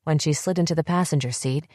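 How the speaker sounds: ordinary delivery, nothing marked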